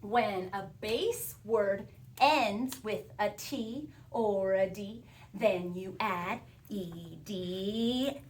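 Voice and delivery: slow, measured word-by-word chant; slow recited rule. Shown as a demonstration. Teacher leading pupils through the spelling rule, slow recitation. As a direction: recite the rule slowly for others to join. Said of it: "let's do it again".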